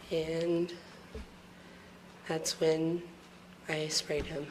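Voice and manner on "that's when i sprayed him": softer, almost inaudible; fading to a murmur; barely audible. Real, not acted. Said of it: "I can't hear the witness".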